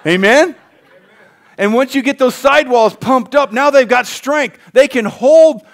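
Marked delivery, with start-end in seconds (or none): 1.6-5.6 higher and louder, building up; rising preaching fervour. Real, not acted. Preacher rallying the congregation, rising and loud. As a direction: lift the congregation with it.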